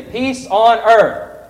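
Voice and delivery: slow, loud drawn-out call; loud proclamation. Shown as a demonstration. Director modelling the crowd's shout. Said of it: "all of you are going to say".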